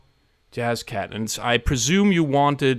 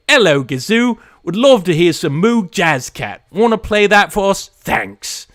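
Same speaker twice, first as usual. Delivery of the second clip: loud, high-pitched mock Cockney; Cockney accent; 8 semitones higher, 8 dB louder than usual. Streamer reading LP Chip's song request, Cockney accent. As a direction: belt it out as a cheeky Cockney caller. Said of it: "you've got a Cockney accent".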